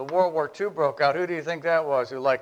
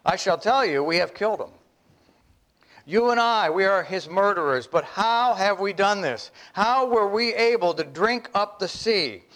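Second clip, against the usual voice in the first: raised, urgent madman's lament; madman proclaiming; 6 semitones higher than usual. Acted. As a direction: accuse everyone, you and I. Preacher voicing the madman's speech.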